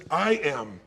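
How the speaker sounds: usual speaking voice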